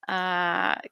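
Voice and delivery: usual speaking voice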